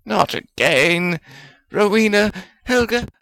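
high, exasperated appeal; pleading complaint. Acted. Wizard appealing to two colleagues, plaintive protest.

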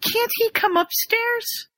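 high, slow whine; high whiny Ron. Retold or imitated. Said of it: "And Ron's like".

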